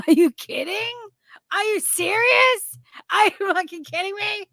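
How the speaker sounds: can't believe what you're seeing; stunned disbelief. Real, not acted. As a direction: pitch jumps up in shock. Reacting in disbelief to picture.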